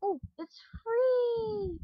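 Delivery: drawn-out, high excited wail; excited squeal. Real, not acted. Player finding free items, thrilled squeal. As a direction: let the delight stretch and rise high.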